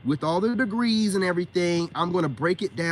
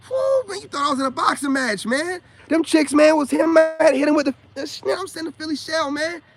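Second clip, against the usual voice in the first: high, loud, hyped-up recounting; real excited bragging; 11 semitones higher, 7 dB louder than usual. Real, not acted. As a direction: relive the fight at full excitement. Excitedly reliving his verbal boxing match.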